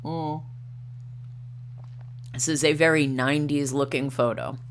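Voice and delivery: ordinary talk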